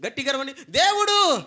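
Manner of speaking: loud, high call; shout. Real, not acted. Preacher scolding a weak cheer, loud shout. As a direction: shout it to rouse them. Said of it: "చిన్నగా అరుస్తున్నారు".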